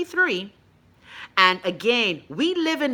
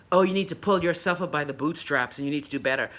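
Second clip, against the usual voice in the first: lower, preachy pull-yourself-up voice; 7 semitones lower, 4 dB quieter than usual. Retold or imitated.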